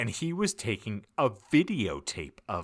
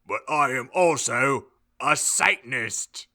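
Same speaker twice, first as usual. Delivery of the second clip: louder, defiant reveal; angry declaration; 3 semitones higher, 5 dB louder than usual. Acted. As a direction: announce it with anger. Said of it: "Hagrid paused angrily".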